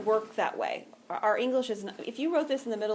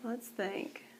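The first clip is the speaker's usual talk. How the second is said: soft unvoiced thinking aloud; hushed aside while puzzling; whisper to herself; 9 dB quieter than usual. Real, not acted.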